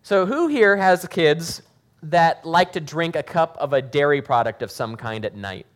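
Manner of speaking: usual speaking voice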